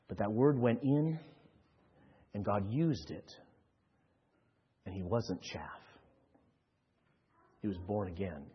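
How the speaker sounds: low, soft, earnest preaching; quiet sermon. Acted. Pastor speaking softly to the congregation, earnest. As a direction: draw the listeners in gently.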